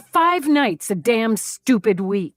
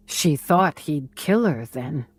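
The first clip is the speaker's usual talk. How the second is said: plain reading voice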